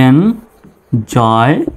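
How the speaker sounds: "Enjoyment" drawn out slowly with a rise at the end; very slow model word. Shown as a demonstration. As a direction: stretch the whole word slowly for the class. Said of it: "সম্পূর্ণ বানানটা হবে". (ধীরে টেনে টেনে "enjoyment")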